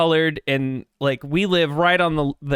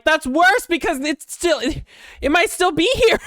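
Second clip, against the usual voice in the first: high, loud panicky squeal; panicked self-quote; 12 semitones higher, 3 dB louder than usual. Retold or imitated. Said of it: "And I'm like".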